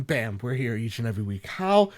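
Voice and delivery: ordinary talk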